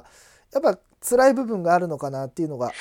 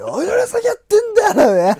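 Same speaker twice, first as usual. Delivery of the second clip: laughing; 14 semitones higher, 9 dB louder than usual. Real, not acted. Laughing retelling of the bit.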